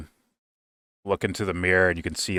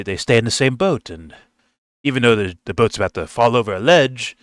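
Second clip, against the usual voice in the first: life coach; 3 semitones higher, 3 dB louder than usual. Retold or imitated. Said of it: "It's like".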